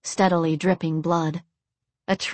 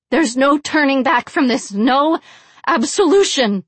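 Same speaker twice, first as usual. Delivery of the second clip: high, loud yelled warning; yelling; 7 semitones higher, 6 dB louder than usual. A narrator's reading. Blonde captive warning her captors, yelling. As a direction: yell it out at the captors. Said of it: "yelled the blonde".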